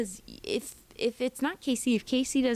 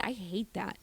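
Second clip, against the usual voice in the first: normal talking voice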